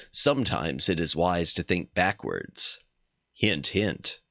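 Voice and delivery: low, soft reading; note read aloud. Acted. Streamer reading the game's note to viewers.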